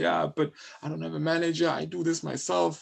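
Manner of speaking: mock protest, pitched well up; complaining. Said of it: "will complain and say".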